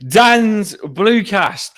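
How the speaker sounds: high, loud drawn-out show opening; slow, loud podcast-host welcome; show intro. Acted. Opening greeting of his show.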